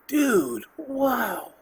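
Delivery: high and loud, overwhelmed wow; a loud, high-pitched dude wow; amazed outburst. Retold or imitated. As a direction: blurt it out, stunned by awe. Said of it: "And I just said".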